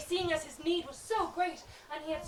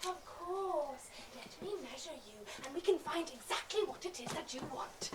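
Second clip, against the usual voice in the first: soft, caressing and attentive; velvety voice; 3 semitones lower, 8 dB quieter than usual. Acted. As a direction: purr like a flattering salesman. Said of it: "his voice turning to velvet".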